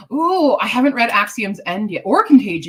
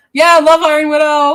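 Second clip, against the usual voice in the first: excited cheering; 8 semitones higher, 10 dB louder than usual. Real, not acted. Gushing over favourite book.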